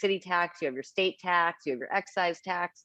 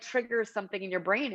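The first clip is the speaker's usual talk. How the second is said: normal talking voice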